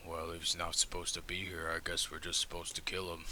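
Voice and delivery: quiet deadpan threat. Acted. Zurich's dry murderous suggestion.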